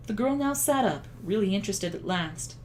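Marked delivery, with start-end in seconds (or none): none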